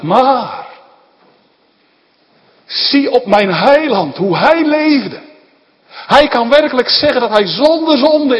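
loud, high, fervent sermon; preaching at full force. Acted. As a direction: thunder it from the pulpit.

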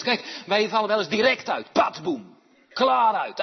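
loud, high, fast pulpit cry; full-force preaching. Acted. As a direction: drive the point home to the congregation.

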